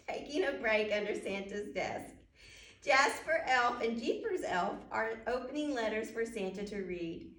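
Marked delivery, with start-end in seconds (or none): none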